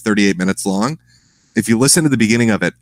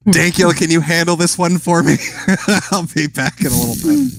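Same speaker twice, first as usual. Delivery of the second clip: laughing mock Sargon; 8 semitones higher than usual.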